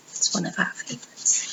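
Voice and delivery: soft unvoiced aside; hushed whisper to the audience. Real, not acted.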